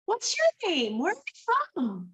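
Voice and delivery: high-pitched, drawn-out hearty greeting; effusive high-voiced welcome; Aunt Tony. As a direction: greet a stranger in a big, high voice. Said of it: "this lady opens the door and she goes".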